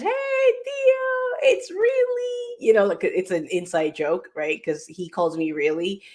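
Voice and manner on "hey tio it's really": imitating herself calling her uncle. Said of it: "like, talking Spanish".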